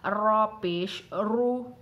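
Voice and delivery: slow, full heavy रू with pesh; drawn-out thick रॉ पेश रू. Shown as a demonstration. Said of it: "पुर का मतलब आवाज़ मोटी होनी चाहिए". (मोटी आवाज़ में रू)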